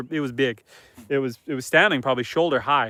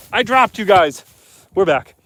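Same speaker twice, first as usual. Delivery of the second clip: louder and higher, telling the viewers; raised voice, higher, telling the chat; louder announcement; 4 semitones higher, 8 dB louder than usual. Real, not acted. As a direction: call it out to the stream so everyone hears. Stream cut out, repeats to viewers.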